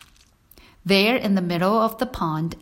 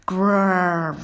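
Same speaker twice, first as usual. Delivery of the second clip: coyote growl. Acted. Coyote returns to eat lamb.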